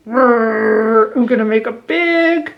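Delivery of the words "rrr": a short, playful mock growl; angry growl. Shown as a demonstration. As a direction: growl along with the class. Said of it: "can you do that with me?".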